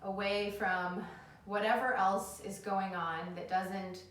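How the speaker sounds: calm them down into the breath; soothing yoga teacher. Acted.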